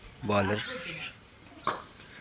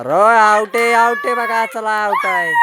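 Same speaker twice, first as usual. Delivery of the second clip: high, loud call that climbs with each out; excited out call; 11 semitones higher, 8 dB louder than usual. Real, not acted. Vlogger calls the batsman out to the kids, rising higher each time. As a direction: announce the wicket, thrilled, rising.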